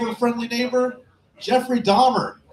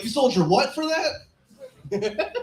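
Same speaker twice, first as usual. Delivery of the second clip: incredulous mock question; 6 semitones higher, much slower than usual. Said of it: "It's like".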